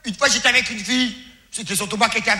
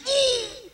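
high surprised hoot; 13 semitones higher, 6 dB quieter than usual. Acted. Reaction to a girl at the door.

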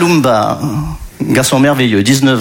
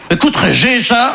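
put-on Congolese-Belgian accent; 7 semitones higher than usual. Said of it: "Il vient du Congo belge".

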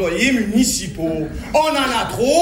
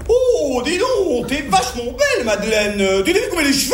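high-pitched mock Parisienne; mock Parisian lady; 4 semitones higher than usual. Lady by the Eiffel Tower admiring Madeleine, mock Parisian accent. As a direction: imitate a Paris lady, dropping her E's. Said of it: "j'entends une dame qui disait à côté de la Tour Eiffel".

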